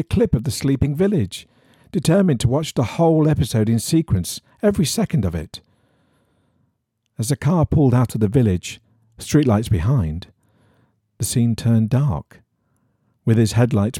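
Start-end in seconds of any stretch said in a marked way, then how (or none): none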